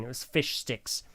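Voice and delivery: ordinary delivery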